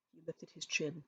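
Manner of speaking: usual speaking voice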